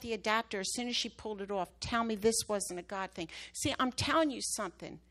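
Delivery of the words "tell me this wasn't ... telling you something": preaching exhortation. Acted. Exhorts the church mid-testimony.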